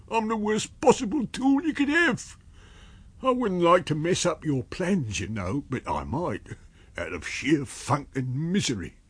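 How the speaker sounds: high-pitched, self-pitying tramp voice; reluctant tramp, miserable. Acted. Miserable tramp warning his invisible master, whining. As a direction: plead your uselessness in a high character voice.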